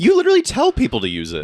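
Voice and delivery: high, loud mock outrage; teasing accusation. Real, not acted. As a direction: call them out, laughing.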